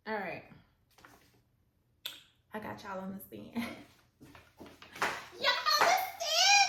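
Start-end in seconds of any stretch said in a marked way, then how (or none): 5.4-6.7 very high, loud squeal; delighted squeal. Real, not acted. Delighted squeal seeing camera view.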